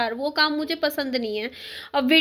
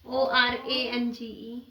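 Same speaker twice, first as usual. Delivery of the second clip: slow, softer letter-by-letter dictation; spelling it out; 3 semitones lower, 6 dB quieter, much slower than usual. Real, not acted. Mother repeating the spelling for her child, slow and softer letter by letter. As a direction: draw out each letter quietly.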